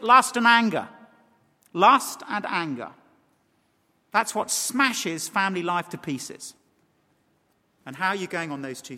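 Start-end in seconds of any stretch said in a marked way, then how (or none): 0.0-6.6 slow, weighty repetition; preacher drives home what destroys family life; emphatic. Real, not acted. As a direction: lean on lust and anger. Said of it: "emphatic repeat, slowed".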